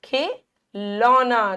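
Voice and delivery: खिलौना slowly, in parts; खि, then a long लौना; sounded-out vowel. Shown as a demonstration. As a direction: stretch the औ in खिलौना. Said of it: "कौन सी मात्रा आती है".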